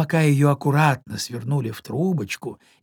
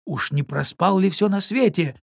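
plain reading voice

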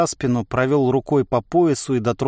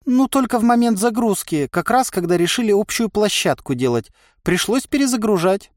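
higher-pitched, chatty inner voice; explaining inner voice; 9 semitones higher than usual. A narrator's reading. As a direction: explain matter-of-factly in a lifted pitch. (внутренний голос объясняет, повыше)

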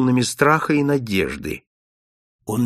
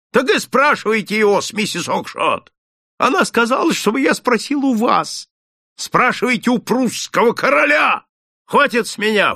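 furious shout; 10 semitones higher, 4 dB louder than usual. Acted. Shopkeeper keeps shouting angrily.

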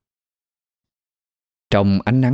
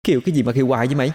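higher, very fast cranky retort; irritated snapping; 5 semitones higher, much faster than usual. Acted. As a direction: snap at a pest who keeps poking you. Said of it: "Thơm nhăn mặt gắt". (gắt gỏng, khó chịu)

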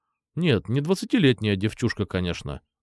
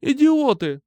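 angry yell; 14 semitones higher, 4 dB louder, slower than usual. A narrator's reading. Girl yelling at bullies.

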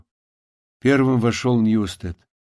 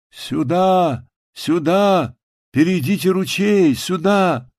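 high calls with long falling vowels; calling out; 7 semitones higher, 3 dB louder, slower than usual. A narrator's reading. Unseen stranger guides rescuers across the stream, calling out. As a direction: hail someone far away, each call drawn out. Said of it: "голос несколько раз позвал".

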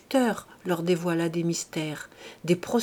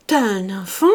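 louder repeat, rising in surprise; growing surprise; 6 dB louder than usual. A narrator's reading. Husband repeats to himself, growing louder in surprise. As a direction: repeat it, louder and more amazed. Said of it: "Il répétait machinalement".

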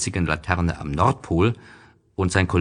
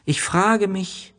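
put-on high, slow child voice; the little prince wondering aloud, high and slow; 14 semitones higher, slower than usual. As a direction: muse slowly in a high boyish voice. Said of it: "sagte er".

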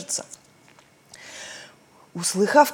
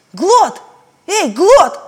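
loud high call; 10 semitones higher, 7 dB louder, much slower than usual. Acted. Girl calls the island by name, loud and high. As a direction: shout the name to the walls.

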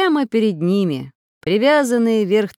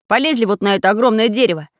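brisk, louder, higher command; rally them with a quick firm order; decisive order; 4 semitones higher, 6 dB louder, faster than usual. A narrator's reading. Leader orders friends up the huge tree, decisively. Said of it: "решительно приказал Самоделкин".

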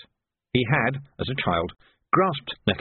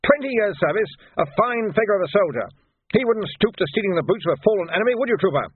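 higher, louder earnest praise; upset major; 9 semitones higher, 3 dB louder than usual. Acted. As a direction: hold up the trooper as example. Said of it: "now quite upset".

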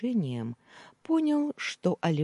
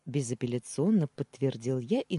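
ordinary delivery, nothing marked

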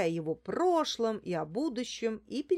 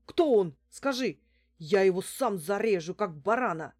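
urgent, louder demand in alarm; agitated man pressing for a name; frightened man; 5 dB louder than usual. A narrator's reading. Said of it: "зачастил испуганный мужчина". (испуганно и горячо)

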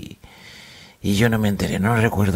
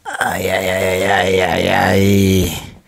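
hungover groaning; much slower than usual. Acted.

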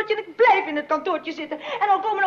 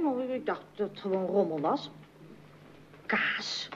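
whispered; 11 semitones lower, 9 dB quieter than usual. Acted. Daughter reads the still life aloud.

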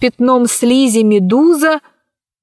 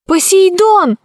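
high startled cry of a name; exclaiming; 9 semitones higher than usual. Acted. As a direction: call out in surprise. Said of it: "воскликнула она".

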